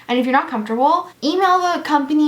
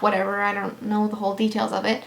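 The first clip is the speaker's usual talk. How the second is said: usual speaking voice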